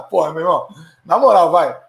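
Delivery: usual speaking voice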